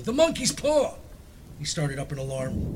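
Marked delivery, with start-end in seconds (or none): 0.0-1.0 high frantic shriek; wild cry. A narrator's reading. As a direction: cry it out wildly. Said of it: "she cried wildly".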